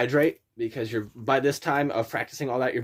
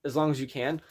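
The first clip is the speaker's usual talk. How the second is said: ordinary talk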